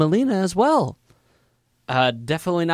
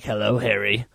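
low, sinister Dafoe impression; Green Goblin voice; 7 semitones lower than usual. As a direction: lower the voice like a menacing villain. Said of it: "Even the scene where it's like".

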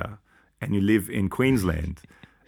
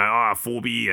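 mock yobbo Aussie; slower than usual. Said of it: "very sort of yobbo-y".

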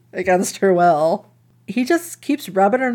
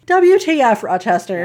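outraged exclamation; 3 dB louder than usual. Real, not acted. Calling out Rochester's behavior, with a loud outraged WTF. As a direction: call out each letter in outrage.

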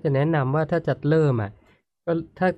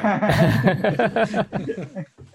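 high, rolling burst of laughter; hearty laugh; 8 semitones higher than usual. Real, not acted. Amused reaction to previous remark.

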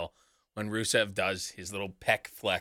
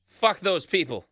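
louder, higher burst of profanity; emphatic repeat; 7 semitones higher, 5 dB louder than usual. Real, not acted. Repeating a curse at the chanting crowd, emphatic. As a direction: say it again with real force and punch.

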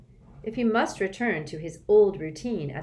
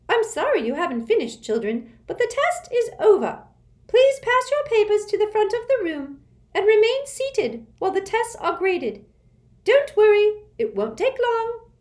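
high, loud prim official voice; a strict, shrill-free schoolmistress; stern test administrator; 8 dB louder than usual. A narrator's reading.

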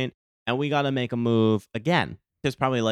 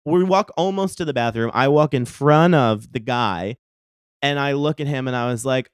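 ordinary talk, nothing marked

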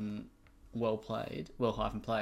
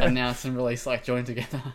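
laughing; 9 dB louder than usual. Real, not acted. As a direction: still laughing while explaining. Laughing at own slip.

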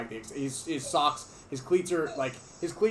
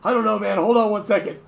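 panicky Alex; 6 semitones higher, 9 dB louder than usual. Retold or imitated. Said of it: "Alex is like".

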